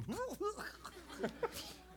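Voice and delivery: high hammed-up sob; mock wail. Boy sobbing as adults storm in, high mock wail. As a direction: wail like a crying kid. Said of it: "I'm sitting there gonna go".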